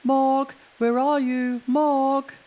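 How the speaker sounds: very high, loud calling out; shouted calling. Acted. Children calling for the lost cat in the rain, loud. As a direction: call across the dark garden. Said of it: "They shouted".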